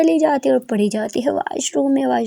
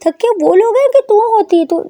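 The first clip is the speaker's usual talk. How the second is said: angry mother; 6 semitones higher, 5 dB louder than usual. A narrator's reading. Mother scolds late daughter.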